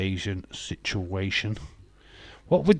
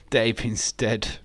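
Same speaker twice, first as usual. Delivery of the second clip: ordinary talk, nothing marked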